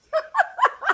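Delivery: loud high burst of laughter; loud delighted laugh. Real, not acted. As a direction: crack up out loud. Laughing at cousin's remark.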